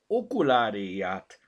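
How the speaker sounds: OKULÁRIÁT loud and slowly drawn out; emphatic correction. A narrator's reading. Corrects the misheard word. („Okuláriát” lassan, nyomatékosan)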